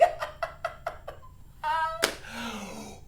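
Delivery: long high laughing fit; laughter tailing off breathlessly; helpless laughter. Shown as a demonstration. Teacher ending the counting game, laughing helplessly. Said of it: "we can't control our laughter".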